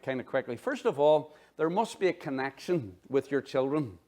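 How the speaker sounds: ordinary delivery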